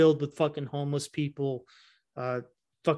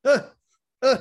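high strained gasping; heart attack gasps; 8 semitones higher, 6 dB louder than usual. Retold or imitated. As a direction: gasp like you're clutching your chest. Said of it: "he's like".

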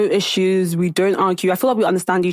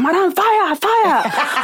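high, urgent imitation of a caller; retold alarm call; 11 semitones higher, much slower than usual. Manager phones the owner about a fire, imitated in alarm. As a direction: cry the news out in mock alarm.

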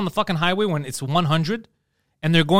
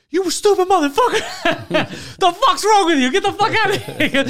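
hammed-up raging yell, much higher and louder, through laughter; mock rage; 4 dB louder than usual. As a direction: play yourself snapping at a teammate, laughing at it. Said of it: "the second somebody makes, like, a dumb mistake".

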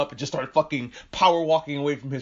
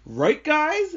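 much higher and louder, a forced chipper "Right, guys?"; fake cheerful Louis; 13 semitones higher, 5 dB louder than usual. Louis, after his grand speech, turns to the carnivores with a bright, much higher, louder "Right, guys?". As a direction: play the smooth deer fishing for agreement. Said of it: "And he's like".

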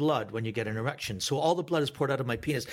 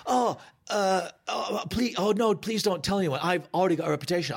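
higher-pitched flustered pleading; Mickey; 4 semitones higher than usual. Retold or imitated. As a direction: beg for secrecy, halting then rushed. Mickey pleading in retold story.

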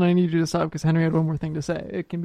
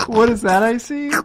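hammed-up discovery, higher and louder; mock detective; 6 semitones higher, 4 dB louder than usual. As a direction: play the shocked investigator. Mock investigation of the bread.

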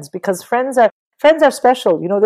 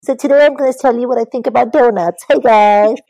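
mock YouTuber; 4 semitones higher, 5 dB louder than usual. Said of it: "what's the point always watching YouTube".

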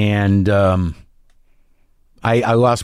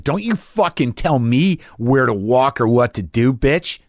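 ordinary talk, nothing marked

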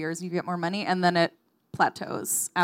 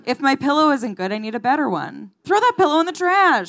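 indignant; 6 semitones higher, 5 dB louder than usual. Retold or imitated. Mimics American consumer demands.

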